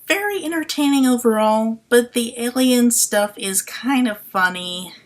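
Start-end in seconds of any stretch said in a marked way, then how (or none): none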